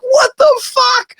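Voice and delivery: very high, drawn-out what the fuck; stunned disbelief. Retold or imitated. The friend seeing him walk up with Dime, stunned. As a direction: gape in total shock.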